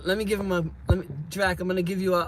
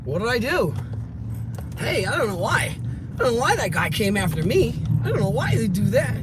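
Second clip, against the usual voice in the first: higher, put-on innocent voice, pleading he knows nothing; mock-clueless good citizen act, raised pitch; 5 semitones higher than usual. Playing dumb to the cops after the fight, in a higher innocent voice.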